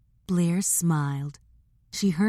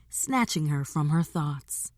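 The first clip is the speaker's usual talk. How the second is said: usual speaking voice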